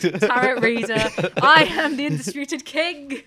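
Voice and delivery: slow, loud declaration; loud boast. Real, not acted. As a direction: proclaim it like a champion.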